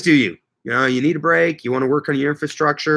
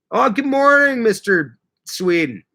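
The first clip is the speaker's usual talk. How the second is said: surprised greeting; 8 semitones higher, slower than usual. Real, not acted. Greeting viewer.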